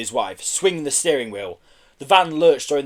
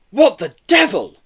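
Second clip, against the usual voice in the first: higher, louder burst of surprise; startled exclamation; 7 semitones higher, 8 dB louder than usual. A narrator's reading. Mister Kelly as police surround the van, startled exclamation. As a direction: exclaim it in astonishment. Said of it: "exclaimed Mister Kelly".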